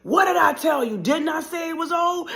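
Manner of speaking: loud, high-pitched shout; acted angry shouting; furious scolding. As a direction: yell it as an enraged mother. Mother screams at child.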